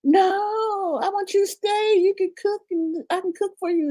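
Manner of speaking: put-on high, pleading girlish voice, the first word whispered; high put-on voice of a clingy woman; imitating a pleading woman. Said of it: "So she was like".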